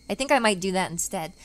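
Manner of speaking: ordinary talk, nothing marked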